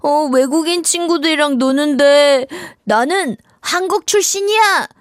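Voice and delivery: high, drawn-out, whiny plea; put-on puppet voice pleading for help; embarrassed character. Yeongjari tells friends an English worry, embarrassed character. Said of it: "영자리의 고민 다 같이 들어볼게요".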